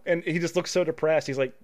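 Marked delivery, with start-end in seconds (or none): none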